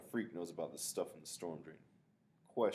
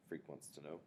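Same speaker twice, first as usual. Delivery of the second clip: usual speaking voice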